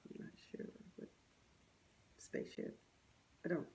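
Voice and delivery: breathy whisper, barely voiced; hushed, confused whisper to the camera; whispered aside. Real, not acted. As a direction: drop to a baffled whisper.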